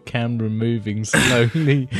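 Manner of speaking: amused, breaking into laughter mid-sentence; laughing. Real, not acted. Co-host watching the buddy's camera slide, laughing. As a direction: talk through rising giggles. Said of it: "I'm just laughing".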